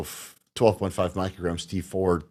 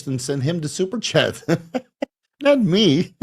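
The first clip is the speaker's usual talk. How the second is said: amused, higher voice breaking into chuckles; laughing; 8 semitones higher than usual. Real, not acted. Host redirecting a viewer's thanks to Chase, laughing. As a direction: laugh as you playfully protest.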